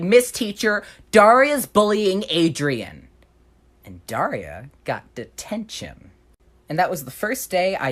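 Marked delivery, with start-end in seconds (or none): 0.0-3.1 higher, louder, slow sing-song tattle; tattling. Retold or imitated. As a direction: drag out the complaint to the teacher. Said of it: "They were like".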